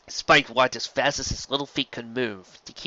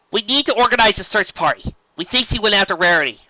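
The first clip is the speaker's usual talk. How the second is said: high, louder, urgent voice; urgent Twilight; 9 semitones higher, 6 dB louder than usual. A narrator's reading. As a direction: press the point with urgency.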